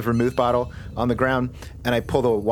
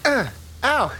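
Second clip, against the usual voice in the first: pained ow; 10 semitones higher than usual. Acted. Hits the door.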